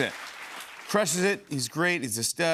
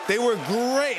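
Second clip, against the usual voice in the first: pure delight, higher again; excited, higher; 8 semitones higher, 5 dB louder, slower than usual. Real, not acted. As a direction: repeat it louder and higher.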